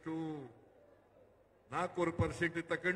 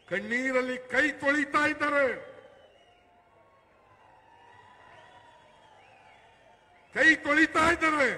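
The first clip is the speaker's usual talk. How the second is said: rally shout; 7 semitones higher, 9 dB louder than usual. Real, not acted. Rally speech, angry outcry.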